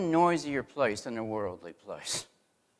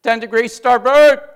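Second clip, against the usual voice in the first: loud, high barked command; captain's order; 9 semitones higher, 16 dB louder than usual. Retold or imitated. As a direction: call the heading across the pilot house. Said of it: "he will say something like this".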